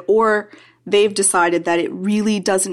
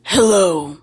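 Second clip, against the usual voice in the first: dragon voice; 4 dB louder than usual. Pretend. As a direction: growl it like a dragon king. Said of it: "her voice from the Total Party Kill podcast".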